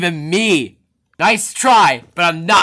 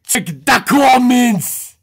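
slow insistent call; 7 semitones higher, 4 dB louder, slower than usual. Acted. Boss points to viewer comments.

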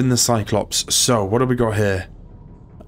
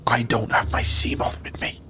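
unvoiced nervous whisper; tense whisper in the dark water; 5 dB quieter than usual. Real, not acted. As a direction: hush it, afraid of being heard. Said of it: "I don't know why I'm whispering".

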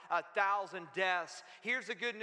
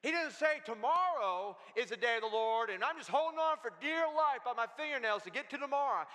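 usual speaking voice

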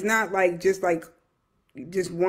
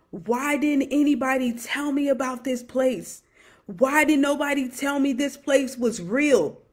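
imitated scream; 6 semitones higher than usual. Grandfather in hell pleading with grandchild, imitated restrained scream. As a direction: cry it out higher, as an anguished plea. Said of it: "he was screaming and said".

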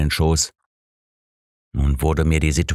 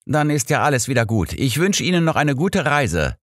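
higher, brisk, cheerful conductor; 9 semitones higher than usual. A narrator's reading. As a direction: play the relieved conductor wishing a good trip. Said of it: "sagte der Schaffner".

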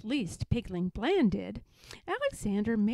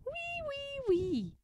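giggling piglet squeal; slower than usual. Acted. Alexander giggling at his mother, very high squeal. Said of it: "interrupted Alexander again".

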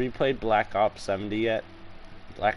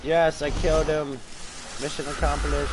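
triumphant cheer; 6 semitones higher, 3 dB louder than usual. Real, not acted. Celebrating a kill in game.

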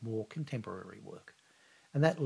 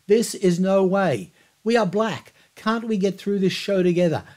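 loud, higher appeal to the crowd; pleading shout; 7 semitones higher, 7 dB louder than usual. Retold or imitated. Brown addresses the crowd from the mic, loud and pleading. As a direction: hold the crowd with a raised, pleading voice. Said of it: "he cried".

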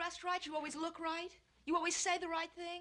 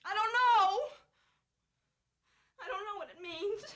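tearful outburst; 3 semitones higher, 4 dB louder than usual. Acted. Wife admits confusion to husband, breaking into tears. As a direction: blurt it out, then crumble.

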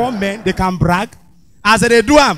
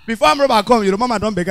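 ordinary talk

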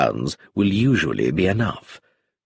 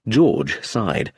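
ordinary delivery

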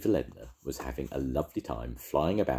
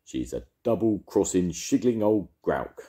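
loud, furious grumbling; angry; 4 semitones higher, 5 dB louder than usual. Acted. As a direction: spit out the insults. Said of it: "Arthur's angry words".

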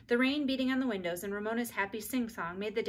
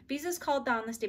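ordinary delivery, nothing marked

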